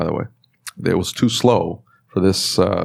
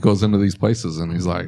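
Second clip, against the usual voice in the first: usual speaking voice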